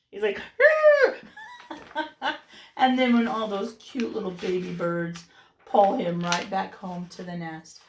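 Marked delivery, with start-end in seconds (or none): 0.5-2.4 high burst of laughter. Real, not acted. Reader laughs at page.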